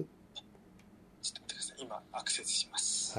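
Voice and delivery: 息だけでそっとささやく; 小声でつぶやくように; ささやき声. Real, not acted. 作業中の小声の独り言. (ささやき声で)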